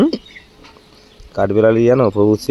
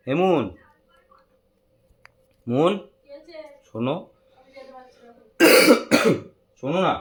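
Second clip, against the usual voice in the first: higher, sing-song calling; 4 dB quieter than usual. Real, not acted. Husband calls his wife to come see the gift, calling out higher. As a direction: call her from the other room.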